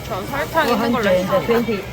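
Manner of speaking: loud. Real, not acted. Ordering loudly at a busy stall.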